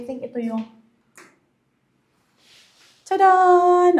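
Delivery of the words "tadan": higher, louder ta-dah; playful reveal. Real, not acted. Showing viewers the cabinet light, a bright excited ta-dah. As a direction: unveil it like a magic trick.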